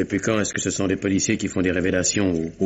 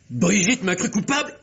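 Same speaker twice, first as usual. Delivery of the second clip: stunned disbelief; 12 semitones higher than usual. Acted. Stunned realization.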